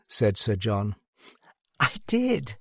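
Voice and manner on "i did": put-on small, high girlish voice; high little voice of a girl; little laughing voice. A narrator's reading. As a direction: pipe up in a tiny, amused voice. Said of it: "a little voice confirmed him, laughing".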